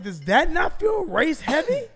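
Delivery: high, slow rising question; incredulous. Retold or imitated. Accused rider challenging Uber investigator, incredulous.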